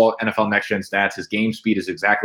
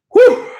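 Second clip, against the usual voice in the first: loud high exhale, out of breath; breathless whew; 10 dB louder than usual. Real, not acted. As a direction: blow out a big winded whew. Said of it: "Sorry, I get out of breath".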